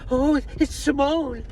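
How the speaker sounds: high, slow, squealed "Oh, it's Simone"; a hammed-up drag queen gushing over the cat; imitating drag queen. Drag queen exclaims, voiced by teller.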